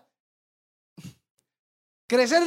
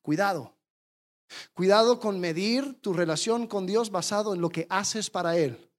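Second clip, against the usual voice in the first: ordinary delivery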